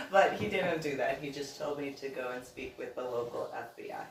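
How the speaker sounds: usual speaking voice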